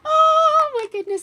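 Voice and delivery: high, loud gasp of delight; giddy. Real, not acted. Streamer thrilled by Harb's present, giddy exclamation. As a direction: start high and giddy, then come down. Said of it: "I am giddy".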